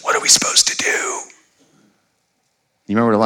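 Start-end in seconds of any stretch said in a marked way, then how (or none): 0.0-1.2 whispered panic to the master; frightened whisper. Acted. Servant whispers to the prophet in terror. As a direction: hush it like the army might hear. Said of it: "he says".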